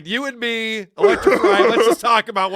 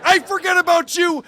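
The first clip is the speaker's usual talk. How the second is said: higher, half-joking indignant rant; mock outrage; 7 semitones higher, 3 dB louder than usual. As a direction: play up the fake fury at Mike. Co-host ranting at Mike.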